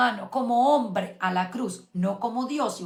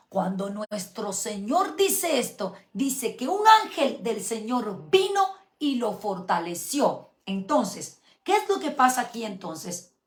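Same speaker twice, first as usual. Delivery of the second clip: ordinary delivery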